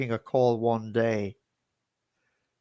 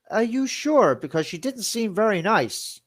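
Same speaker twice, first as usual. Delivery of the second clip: quoting the relative; 9 semitones higher than usual. Retold or imitated. Relative doubting, quoted.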